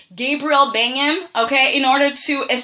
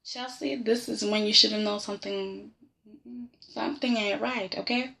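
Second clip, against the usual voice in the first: much softer, knowing aside; softer warning aimed at Chelsea; quiet aside; 4 semitones lower, 12 dB quieter than usual. Retold or imitated. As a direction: murmur it like a warning to the screen. Advising Chelsea from the couch.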